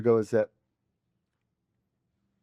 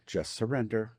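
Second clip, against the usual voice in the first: soft mantra; 4 semitones lower, 7 dB quieter than usual. Shown as a demonstration. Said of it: "my mantra".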